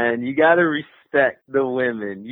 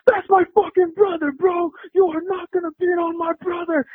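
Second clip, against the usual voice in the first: protective fury. Retold or imitated. Buddy pinning the attacker, yelling to defend his brother. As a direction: imitate the buddy yelling while choking the guy. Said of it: "and he's like".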